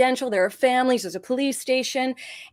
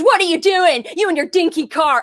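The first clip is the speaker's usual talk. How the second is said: put-on loud, higher, scolding old woman barking at the driver; angry old lady; 5 semitones higher, 7 dB louder than usual. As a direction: give it the furious neighbour-lady bite. Angry old lady confronts her.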